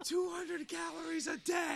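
high, slow exasperated shout. Real, not acted. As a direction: cry it out in disbelief. Complaining about being sick.

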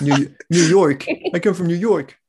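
loud, high, hammed-up New Yorker; New York accent. Shown as a demonstration. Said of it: "Do you guys do American accents?".

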